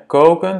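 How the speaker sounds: "Koken" with a long, stretched O, high and loud. Shown as a demonstration. Teacher models the long vowel in Koken. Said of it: "c'est un O long".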